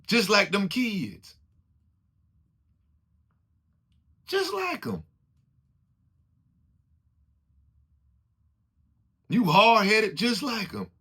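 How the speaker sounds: high, loud, slow preaching climax; fervent preacher building to the top of his voice; preaching at full force. Real, not acted. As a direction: drive each line home like a pulpit peak. Sermon climax, rising intensity.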